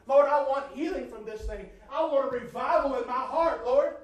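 loud, high preaching climax; full-force preaching. Acted. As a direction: lift the whole room with it.

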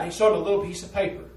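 normal talking voice

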